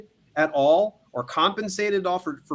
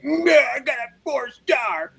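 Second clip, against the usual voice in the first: mock offended author; 10 semitones higher, 4 dB louder, slower than usual.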